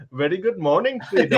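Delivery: put-on high, laughing Indian accent voice; a playful, laughing Indian accent impression; mock Indian accent. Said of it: "if I started going".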